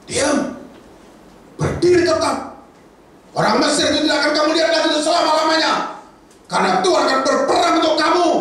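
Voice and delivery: high, loud proclamation; full-force preaching. Acted. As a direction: thunder it out like Moses to the people. Proclaiming Moses' words. (berkhotbah lantang dengan suara tinggi)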